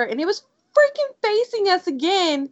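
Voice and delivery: ordinary delivery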